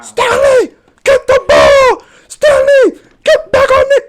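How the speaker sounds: very high, loud sideline scream; coach screaming. Retold or imitated. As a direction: scream orders like a coach gone Hulk. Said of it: "he turned into the Hulk".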